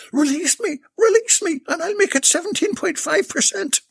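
high shriek building on the second release. Acted. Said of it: "she shrieked".

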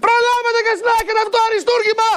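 high-pitched hard-sell shout. Real, not acted. TV seller rushing viewers to grab the masterpiece, shouting. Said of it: "μη φωνάζετε".

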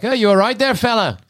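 calling out to him, high and loud; shouting out. Acted. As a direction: call out across the distance to a stranger. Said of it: "I'm just gonna shout out to him".

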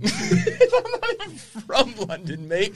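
high, slow mock-British accent; fake British accent. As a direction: draw it out in a silly British accent, laughing. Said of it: "like, a shitty British accent".

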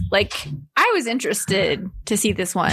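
usual speaking voice

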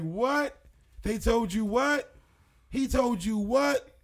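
high, loud, slow disbelieving what; 믿을 수 없다는 듯 크게 되묻기; flabbergasted. Retold or imitated. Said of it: "They was flabbergasted".

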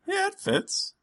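high, thin NPC voice; squeaky character voice. Acted. Answers as the coat-giver.

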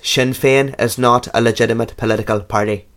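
stern practice phrase in Northern Irish; Northern Irish accent. Shown as a demonstration. Co-host's phrase for getting into Irish, Northern Irish accent. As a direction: put on a Belfast accent. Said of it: "That's Northern Irish".

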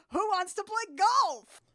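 high, loud, slow goofy bird; silly cartoon bird voice. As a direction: chirp it like a cartoon goose. Goose and duck voiced cheerfully.

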